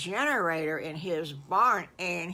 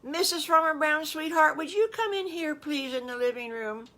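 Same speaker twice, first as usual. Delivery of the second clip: raised, high voice calling to the next room; husband calling his wife from the living room, loud and high; 8 semitones higher, 5 dB louder than usual. Acted. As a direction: call her in from the kitchen.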